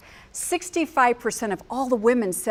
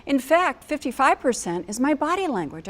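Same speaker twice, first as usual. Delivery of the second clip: ordinary delivery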